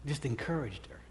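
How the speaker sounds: soft unvoiced whisper; moved father whispering what his daughter did. Real, not acted. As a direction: breathe it almost silently. Whispered aside recalling daughter.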